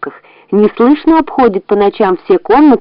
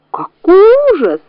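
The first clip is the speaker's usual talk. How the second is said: high, shrill-pitched cry of disgust, much higher than the narrator; disgusted wife's shriek; 10 semitones higher than usual. A narrator's reading. The wife recoils from the rat's tail, shrieking high in disgust. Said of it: "закричала хозяйка и вся передёрнулась".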